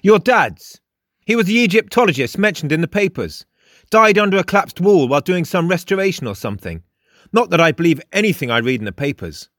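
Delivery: high, fast character voice; matter-of-fact Frost. A narrator's reading. Frost briskly explains the death.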